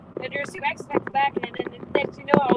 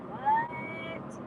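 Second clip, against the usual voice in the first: high, drawn-out "what"; softly squeaked mock disbelief; baffled retelling; 7 dB quieter than usual. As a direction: stretch it out softly in retold bafflement. Retold confused reaction to login prompt.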